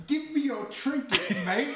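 laughing British accent imitation; mock British accent. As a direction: ham up a British tough guy. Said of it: "And he's like".

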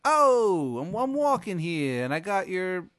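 loud, high mock New York alien; alien Tony. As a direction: belt it like a cabbie, then settle. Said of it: "he's like".

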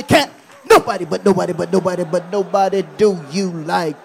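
loud call-and-response chant; shouted call. Shown as a demonstration. Preacher leading church to shout at somebody, loud. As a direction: shout it to your neighbour. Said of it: "Shout at somebody".

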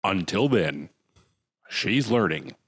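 low, measured imitation of a TV reporter; portentous voiceover with pauses; mock news narrator. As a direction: join in parodying the reporter's sign-off. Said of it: "can I do speaking gigs like that".

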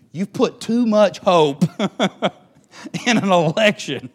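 preacher cracking up at his own joke, laughing. Real, not acted. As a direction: laugh through the punchline. Laughing through the line.

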